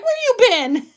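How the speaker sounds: mock demand. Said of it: "be like".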